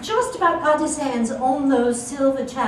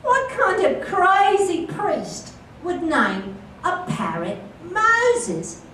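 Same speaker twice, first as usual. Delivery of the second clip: incredulous; 6 semitones higher, 4 dB louder, much slower than usual. Acted. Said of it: "He said".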